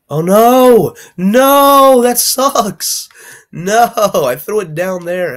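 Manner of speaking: high, loud cry of dismay; real shout of loss, dragged out at the end; oh no, it's gone; dismayed yell. Real, not acted. Item lost in game, real dismay.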